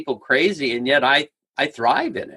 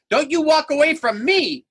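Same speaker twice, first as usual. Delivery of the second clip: high-pitched, hammed-up confrontation; mock threat; 9 semitones higher than usual. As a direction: play at chasing someone down with a threat. Mock confrontation with stranger leaving.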